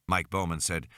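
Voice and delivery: usual speaking voice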